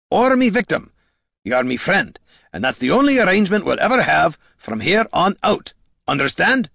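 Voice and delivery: high, forceful hero's outburst; emotional, emphatic character voice, pitched well up; impassioned Gorm. Acted. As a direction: declare friendship as if giving orders, fiercely.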